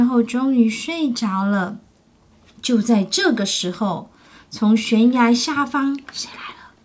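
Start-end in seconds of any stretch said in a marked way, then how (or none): 6.1-6.7 hushed suspense whisper. Acted. Storyteller asks child who comes, in a hush. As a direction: breathe the question to build suspense.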